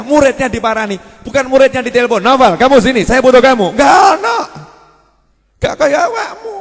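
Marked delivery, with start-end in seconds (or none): 2.2-3.7 mock summons. Meniru guru memanggil murid. 3.7-6.6 loud, high shout at the crowd; real shout. Real, not acted. Sindiran keras pada jemaah.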